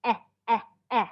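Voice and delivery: repeated short eh vowel; teacher sounding out eh. Shown as a demonstration. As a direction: model the short e, eh, eh. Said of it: "What about short E sound?".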